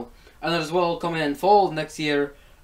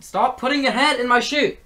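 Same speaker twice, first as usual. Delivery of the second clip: higher, louder scold at the cat; 9 semitones higher, 6 dB louder than usual. Real, not acted. Vlogger scolding the cat with its head in her shoe, higher and louder. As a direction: snap at the pet to stop.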